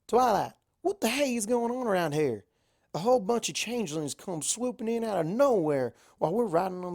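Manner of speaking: hollering like a flustered farm pony; a loud country mare demanding answers; Applejack's country twang. Acted. Applejack voiced in the story.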